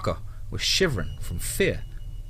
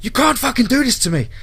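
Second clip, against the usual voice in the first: very high, frantic screaming tirade; yelling into phone; 12 semitones higher, 5 dB louder than usual. A narrator's reading. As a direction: scream in panicked outrage down the phone. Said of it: "Brady yelled into the phone".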